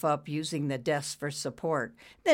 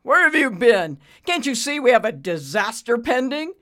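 loud, high-pitched scolding; angry berating; 7 semitones higher, 9 dB louder than usual. A narrator's reading. Boss to late maintenance man, angry berating. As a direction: tear into him.

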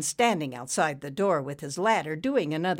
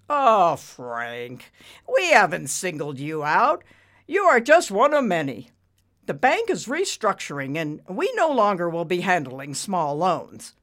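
lightly patronizing, louder voice; arrogant banker, patronizing; 2 semitones higher, 7 dB louder than usual. A narrator's reading. Banker explaining to borrower, patronizing. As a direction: brush him off condescendingly. Ricky's character voice.

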